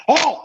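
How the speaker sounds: sudden high, loud "oh"; mock eager gulp of an exclamation; imitated lunging "oh". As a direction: burst out with a high "oh". Imitates her sudden eager lunge.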